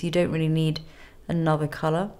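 ordinary talk

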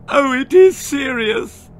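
very loud, high bawl. Acted. Mother Sissy sure it's serious, loud high bawl. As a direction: bawl in despair. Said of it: "bawled Sissy".

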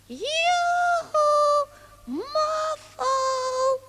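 long drawn-out yoo-hoo call. Acted. Piertje looking for Moffel outside, calling out. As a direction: holler for someone out of sight.

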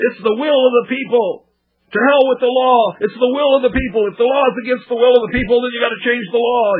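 high-pitched mock protester yelling. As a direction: ham up a crowd-pleasing shouter playing to the camera. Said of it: "so he's just yelling".

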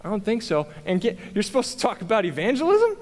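mocking inner critic; doubting self-talk. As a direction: question yourself in disbelief, pitch climbing on each question. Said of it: "this is what I'm, I'm telling myself".

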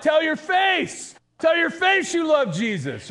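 high, loud, stretched shout; pretend yell. Preacher shouting at sourpuss singers, high and loud. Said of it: "I wanna yell out".

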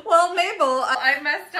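put-on high, loud old-timey lady laughing; a giggly forties radio housewife; 1940s radio voice. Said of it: "somebody being like".

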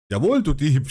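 lauter, zorniges „Jawohl“; zorniger Schrei. A narrator's reading. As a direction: schreit den Dieb an. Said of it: "schrie ich". (Vater schreit seinen Sohn lauter an)

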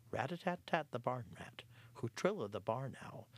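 plain reading voice

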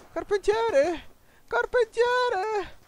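very high, slow squeaky echo of the word; mock high call. Gamer parroting the game announcer in a high squeak.

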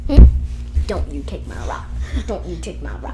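dog voice. Retold or imitated. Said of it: "he's just like".

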